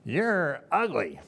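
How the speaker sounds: high, drawn-out parrot voice; squawking parakeet. Acted. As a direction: say it slowly like a cheeky parakeet. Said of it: "the parakeet from its perch looked up at her and said".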